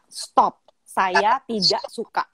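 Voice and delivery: measured, slow command, each word separate; firm. Retold or imitated. Mother coaches daughter to stop boy, slow firm demonstration. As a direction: model a firm refusal, unhurried. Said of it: "bilang sama dia dengan tegas".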